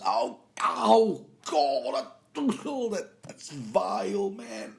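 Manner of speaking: high, loud disgusted recoil with a laugh; disgusted Shaun. Retold or imitated. Podcaster acting Shaun smelling his friend's fart, disgusted. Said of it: "Shaun smells it and goes".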